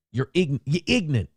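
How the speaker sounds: ordinary talk, nothing marked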